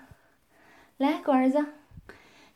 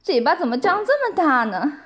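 ordinary talk, nothing marked

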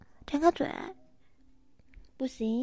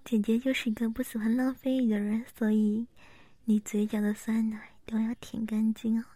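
ASMR whisper. Acted. (贴耳轻声耳语)

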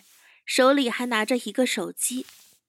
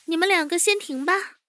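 higher-pitched, controlled order; higher young boss's voice; chief calling a halt; 6 semitones higher than usual. Acted. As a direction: tell your men to stop, composed. Chief halts his men.

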